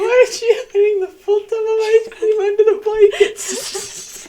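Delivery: high, slow words squeezed out through laughter; laughing question. Real, not acted. Sibling laughing at the ice cream story, laughing hard. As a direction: ask it while cracking up at the story.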